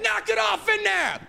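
mock dad yell. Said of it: "My dad's like".

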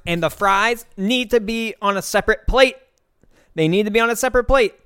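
high-pitched imitation of a mad, insistent customer; mock an irritated diner laying down demands; not nice, demanding. Fussy customer ordering from the server, demanding. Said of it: "she wasn't really the nicest to the server".

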